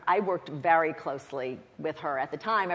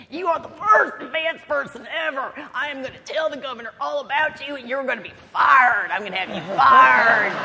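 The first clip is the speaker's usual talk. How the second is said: higher, loud angry tirade building to a shout; furious scolding; 7 semitones higher, 7 dB louder than usual. Retold or imitated. Family friend scolding the late advance staffer, loud angry shout. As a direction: chew her out and threaten to fire her. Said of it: "he says".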